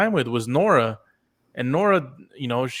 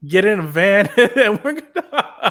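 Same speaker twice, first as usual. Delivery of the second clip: laughing; 8 semitones higher, 6 dB louder, slower than usual. Retold or imitated. Mocking the execution scene, laughing.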